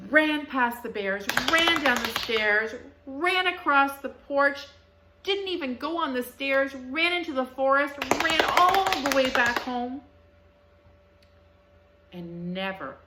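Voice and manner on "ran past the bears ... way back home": louder, urgent storytelling; mounting chase excitement; excited rush. A narrator's reading. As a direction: tell the escape breathlessly. Goldilocks flees, story climax.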